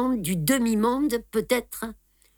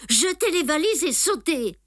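urgent command; 8 semitones higher than usual. Acted. Urgent order leaving train.

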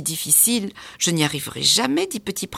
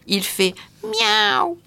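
put-on meowing child; 4 dB louder, much slower than usual. A narrator's reading. Child imitates a cat.

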